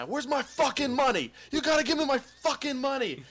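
high-pitched imitated yell of an enraged debt collector; retold angry yell. Guy in parking lot demanding his money, retold yelling. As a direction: yell it out as the furious creditor, stretched and high. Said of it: "he starts yelling at this guy".

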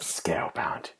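whispered echo. Real, not acted. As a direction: hush it like a sad memory. Echoing the whispered game name.

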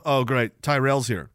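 ordinary talk

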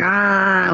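sustained high mock scream; imitated scream. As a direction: hold a high "ah" at near-normal volume. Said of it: "like that".

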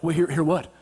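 low, halting husband voice; imitated baffled husband. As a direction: drop low and stumble, confused. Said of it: "And I'm just like".

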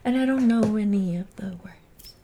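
whispered aside. Real, not acted. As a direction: whisper the confession. Whispered aside after singing.

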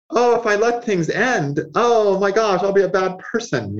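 high-pitched, hammed-up worry; mock fretting. Saturn afraid to let things end, mock worried. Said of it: "my star Saturn is like".

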